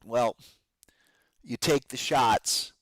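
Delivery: ordinary talk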